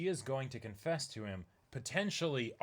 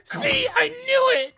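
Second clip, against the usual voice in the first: very high, loud and slow, yelled out; triumphant shout; 15 dB louder, slower than usual. Acted. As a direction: scream it like you just called it. Acted character shouting triumphantly.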